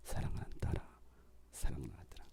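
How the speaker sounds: very soft, low, loving murmur; tender divine call. Acted. Jesus calling the praying listener, hushed tender voice. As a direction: whisper it low to a beloved child. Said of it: "여러분의 이름을 부르시는 거예요".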